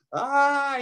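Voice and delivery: high-pitched storyteller's yelp; sudden held cry of pain, acted; high mock scream. A narrator's reading. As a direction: yelp high as if hair was plucked. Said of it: "he screamed".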